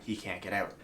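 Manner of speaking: usual speaking voice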